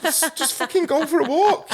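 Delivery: high laughing exasperated retort. Retold or imitated. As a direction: laugh it out while telling her off. Parents answering the teen.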